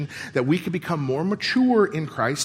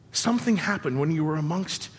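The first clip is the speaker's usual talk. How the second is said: ordinary talk, nothing marked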